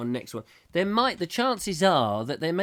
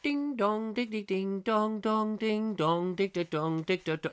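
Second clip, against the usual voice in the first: high sing-song chimes; playful high ding-dong chime imitation; glockenspiel ding dong; 8 semitones higher than usual. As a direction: mimic a chiming glockenspiel. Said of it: "ready to go".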